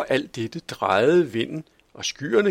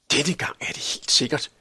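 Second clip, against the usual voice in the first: to himself; 4 semitones higher than usual. A narrator's reading. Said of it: "sagde Tobias til sig selv".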